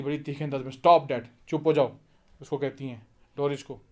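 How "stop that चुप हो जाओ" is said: sharp, louder rebuke; sharply. Acted. Mother sharply silencing her daughter, a louder rebuke. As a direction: snap it out to shut her up. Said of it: "कहती हैं sharply".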